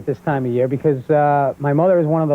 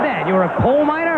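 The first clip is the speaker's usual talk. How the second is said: put-on high-pitched, disbelieving young son; incredulous kid; 12 semitones higher than usual. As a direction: question Dad as a baffled kid. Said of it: "I go".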